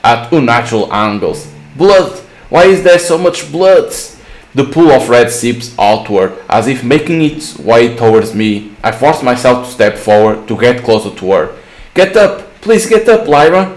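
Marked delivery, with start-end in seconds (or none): 1.8-3.9 higher-pitched mild panic; acted alarm at a horrific sight; panicked. Narrator panics at friend's body. 11.9-13.7 high-pitched, urgent plea; acted panic, pitched well up; desperate pleading. Begging injured friend to get up.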